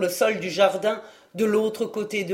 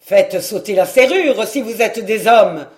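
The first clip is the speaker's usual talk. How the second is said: louder, a captain's shouted order; commanding cry; 8 dB louder than usual. A narrator's reading. Olivier to the soldiers outside, a loud commanding cry. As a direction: shout it through the gate to the soldiers. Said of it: "cria Olivier de Raguenel".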